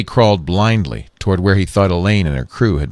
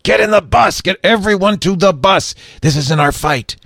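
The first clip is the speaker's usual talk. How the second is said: urgent shout; 11 semitones higher than usual. A narrator's reading. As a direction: yell them toward the bus. Lee Daniel shouting orders.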